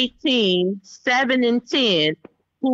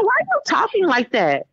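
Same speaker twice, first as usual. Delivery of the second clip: high, incredulous questioning; affronted mother; 9 semitones higher than usual. Retold or imitated. Mother to son who talked back, high affronted disbelief. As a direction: imitate a mother pulling up her child, pitched high.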